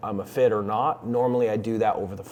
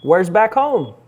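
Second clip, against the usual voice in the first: raised, higher phone voice; loud phone voice; 8 semitones higher, 10 dB louder, slower than usual. Real, not acted. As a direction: project up for a weak line.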